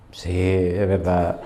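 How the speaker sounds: low, slow, laughing confession; caught-out sinner admitting it; sheepish admission. Retold or imitated. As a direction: own up sheepishly, dragging it out. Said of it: "Me dice".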